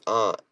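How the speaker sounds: "aunt" said as "ahnt"; ahnt pronunciation. Shown as a demonstration. Reading out a viewer's version, "aunt" said as "ahnt". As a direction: open ah vowel in "aunt". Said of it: "Katie says".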